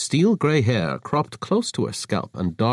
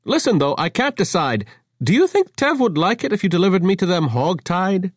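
bright musing; 10 semitones higher, 4 dB louder than usual. Acted.

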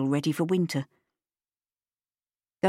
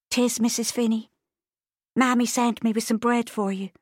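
put-on higher girlish voice; young Irish girl; 6 semitones higher than usual. A narrator's reading. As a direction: answer politely as a girl. Bridie answers, a girl.

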